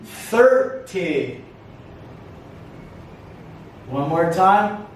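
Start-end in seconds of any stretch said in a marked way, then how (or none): 0.0-1.4 THIR in "thirty" pitched up, then falling; "thirty" slowly, lifting the first syllable; stress on first syllable. Shown as a demonstration. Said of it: "Listen for the stress".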